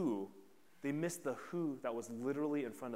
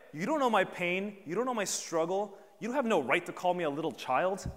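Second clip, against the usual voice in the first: loud, high, wounded protest; imagined indignant rant; 6 semitones higher, 7 dB louder than usual. Retold or imitated. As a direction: push back hard, offended. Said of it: "He could have been like".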